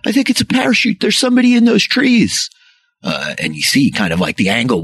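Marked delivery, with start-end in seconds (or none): 0.1-2.5 calling out. Acted. Group members calling out about the parachute. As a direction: shout to the others, pointing. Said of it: "folks, like, calling".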